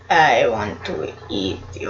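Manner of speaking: menacing monster voice. Acted. Voicing the hungry big cell.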